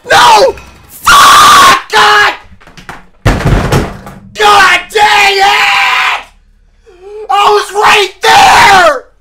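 furious screaming. Real, not acted. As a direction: scream in despair at the failed jump. Falls near goal in game.

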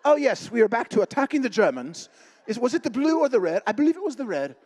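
high, loud mock-British officer; British airman. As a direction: ham up a dithering British pilot, voice lifted. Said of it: "The British during the World War Two".

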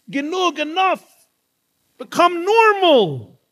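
high-pitched, building acted reproach; scolding outburst. Acted scolding woman on a plane.

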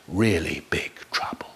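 hushed whisper. A narrator's reading. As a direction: whisper the ending ominously. Ominous ending aside.

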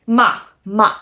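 punchy downward MA; emphatic falling tone. Shown as a demonstration. Teacher showing the fourth tone, very emphatic. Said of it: "It is very emphatic".

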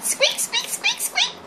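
very high, tiny squeaking mouse voice; mouse squeaks. Pretend. Finished balloon mouse squeaking for the viewers, very high squeaks. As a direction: squeak like a little toy mouse.

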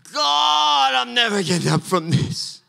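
high, loud hammed-up lament; mock pity-party wail. Pastor imitating his own complaint to God, hammed-up wail. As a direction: overplay the self-pity to God. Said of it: "I got really into it".

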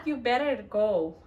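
usual speaking voice

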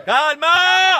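one long, very loud call; shouted order. Real, not acted. Player tells teammates to settle down, shouting. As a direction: yell for calm across the pitch. Calling for calm in play.

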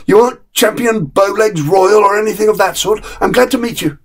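a dog delighted to find his own kind; relieved dog. Acted.

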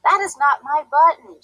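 a touch of a frustrated shout; mild shout. A narrator's reading. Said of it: "shouted Toad".

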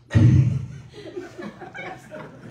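high unvoiced giggling laugh; high burst of laughter. Real, not acted. Pastor cracking up at his own bath joke, high laugh.

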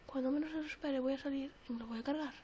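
hushed, scheming whisper; plotting whisper. Real, not acted. Planning to ambush the enemy, scheming whisper.

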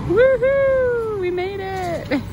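excited cheer. Real, not acted. Arriving at the park.

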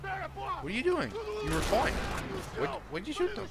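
high-pitched, incredulous questioning; real exasperated disbelief. Real, not acted. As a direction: protest in a high, baffled voice. Reacting to game character shooting people.

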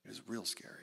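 preacher quietly repeating real scary; quiet echo. Real, not acted. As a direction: let it sink in, said softly. Echoed quietly after long pause.

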